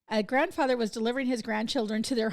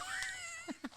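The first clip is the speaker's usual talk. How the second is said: high laugh; 13 dB quieter than usual. Real, not acted. Laughing at joke.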